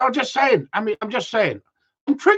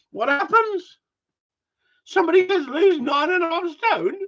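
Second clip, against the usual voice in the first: higher, incredulous complaint; exasperated; 10 semitones higher than usual. Real, not acted. Complaining to viewers about the advice, high and incredulous. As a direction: whine it in disbelief.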